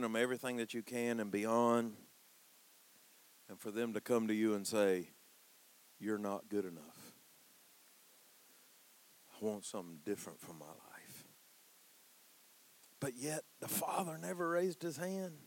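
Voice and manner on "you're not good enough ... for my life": quiet son's words. Retold or imitated. Son's words, spoken hushed.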